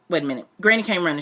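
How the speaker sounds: ordinary delivery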